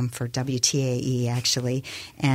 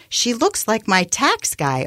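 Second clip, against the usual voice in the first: high, exasperated outburst, drawn out; erupting; 9 semitones higher, 4 dB louder than usual. A narrator's reading. Boss complaining about his anchor's hair, exasperated outburst. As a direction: burst out slowly and high-pitched, acted in narration. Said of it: "Art erupted".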